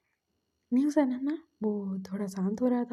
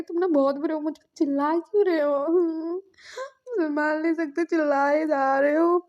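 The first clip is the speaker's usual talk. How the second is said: higher, whimpering tearful complaint; acted crying; 5 semitones higher, 4 dB louder than usual. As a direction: whine accusingly through mock sobs. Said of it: "बोल के वो बेचारा रो रहा था". (रोते हुए, ऊँची आवाज़ में शिकायत)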